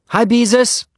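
high, loud called greeting; calling out. A narrator's reading. Henry hails Beezus through the clubhouse window, calling out. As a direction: call to someone outside through a window. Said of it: "he called through the window".

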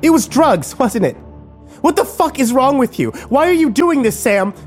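high, loud furious screaming; furious shout at Sam. A narrator's reading. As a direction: scream at him in betrayal. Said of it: "Kimber screamed".

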